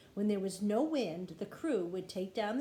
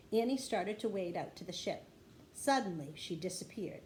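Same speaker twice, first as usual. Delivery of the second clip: ordinary delivery, nothing marked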